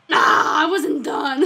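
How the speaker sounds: laughing protest. Real, not acted. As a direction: yelp then laughing complaint. Drawing cut off, laughing protest.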